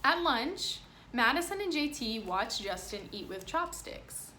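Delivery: lively picture-book reading voice; reading to children. Acted. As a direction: read it aloud to a class. Reads picture book to class.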